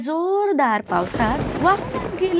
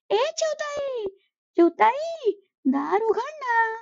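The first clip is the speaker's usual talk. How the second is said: higher pleading crow voice calling out; crow calling at the door; 10 semitones higher, slower than usual. Acted. Cold crow begs the sparrow to open her door, pleading call.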